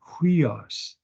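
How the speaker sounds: "Huios" begun with a clear H; H sound. Shown as a demonstration. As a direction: breathe an H into the front of "Huios". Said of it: "Put an H sound".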